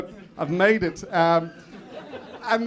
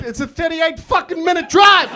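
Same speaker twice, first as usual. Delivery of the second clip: high, loud indignant shout; exasperated outrage; 8 semitones higher, 7 dB louder than usual. Acted. Comedian realising he was fooled, exasperated shout. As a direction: shout it in comic disbelief. Punchline outrage at short move.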